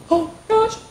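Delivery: high squeaky frightened oh gosh; a child's alarmed squeak; frightened gasp. Acted. Fright in story.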